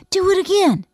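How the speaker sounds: high, loud excited urging; eager request. A narrator's reading. Boy wants to hear the scream repeated, loudly eager. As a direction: ask keenly for an encore.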